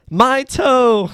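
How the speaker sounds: dad losing toe, hammed-up wail; mock anguish. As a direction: wail it like a soap actor. Voicing dad in soap parody.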